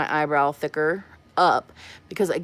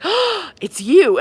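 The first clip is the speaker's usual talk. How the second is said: much higher, louder, slow surprised call; delighted recognition; 11 semitones higher, 7 dB louder, much slower than usual. Retold or imitated. Stranger in Marshall's recognizing her, slow high delighted call. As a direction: stretch it out like spotting a star. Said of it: "and she's like".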